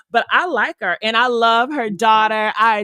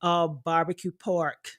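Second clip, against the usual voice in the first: ordinary talk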